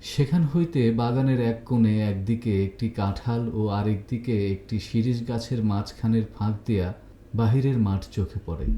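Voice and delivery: ordinary delivery, nothing marked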